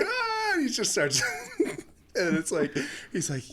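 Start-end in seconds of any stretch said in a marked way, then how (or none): 0.0-0.5 high-pitched comic wail; imitated tearful cry, pitched way up; mock crying wail. Retelling the man who starts crying at the kill, mock wail. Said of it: "You don't have to cry so much".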